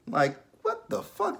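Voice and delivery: squeaky high, slow what the fuck; shocked outrage. Real, not acted. Vlogger disgusted by the close-up, high-pitched outrage. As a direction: jump way up in disbelief.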